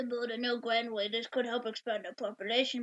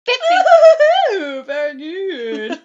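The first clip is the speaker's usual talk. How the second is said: loud, high then slow gloat; gleeful character voice; 6 semitones higher, 19 dB louder, much slower than usual. Acted. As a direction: drag out the delight greedily. Character gloats over gold.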